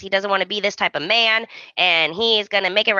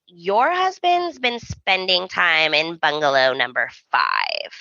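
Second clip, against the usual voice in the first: slow, deliberate sassy waiter; imitating a smug waiter dropping news; 3 semitones lower, slower than usual. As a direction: drag it out and drop very low on the last word. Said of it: "the same sassy waiter".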